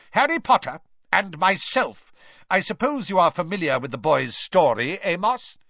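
loud, high, indignant challenge; barking Mister Crouch. A narrator's reading. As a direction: press it sharply, rising at the end. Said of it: "barked Mister Crouch".

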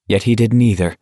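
ordinary delivery, nothing marked

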